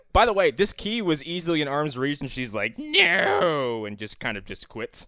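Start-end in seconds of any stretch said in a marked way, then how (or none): 2.8-3.9 high, drawn-out mock "no"; mock wail. Queen gives up reaching the key, high mock wail. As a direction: wail it like a hammed-up quitter.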